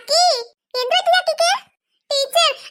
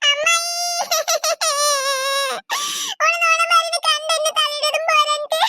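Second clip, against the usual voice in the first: hammed-up sobbing wail; crying. Child telling tales to her aunt, crying. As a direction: bawl the complaint, long sobs between phrases. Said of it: "എന്തിനാ, എന്തിനാ കുഞ്ഞിപ്പാത്ത് കരയുന്നത്?".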